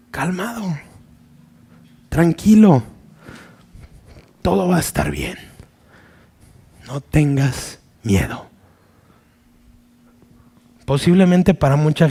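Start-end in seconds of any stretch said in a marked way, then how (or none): 0.1-0.8 "calmado" very soft, falling gently; calm and tender. Acted. Someone arrives in the storm, calming you softly. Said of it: "con qué autoridad y con qué amor". 2.1-8.5 calm and tender. Acted. Someone reaches you in the storm, tender and hushed. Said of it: "con qué autoridad y con qué amor".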